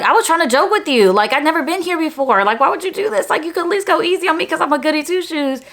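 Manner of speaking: high-pitched, wounded complaint; pleading protest. Retold or imitated. Paddled student protesting to the principal, pleading. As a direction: plead your case, pitch held up high.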